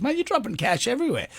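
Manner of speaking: high-pitched mate-to-mate alert; startled bartender. Acted. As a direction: point it out in surprise, pitch up. Bartender startled by falling cash.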